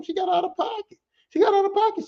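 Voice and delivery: high whiny complaint. Acted. Comic indignant whine.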